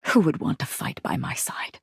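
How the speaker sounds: whisper. Acted. Whispered question.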